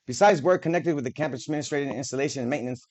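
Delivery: ordinary talk, nothing marked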